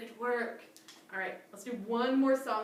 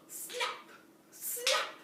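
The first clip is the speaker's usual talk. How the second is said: higher breathy snap sounds; mock alligator snaps; 14 semitones higher than usual. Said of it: "he goes".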